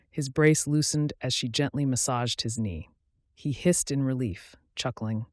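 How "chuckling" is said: usual speaking voice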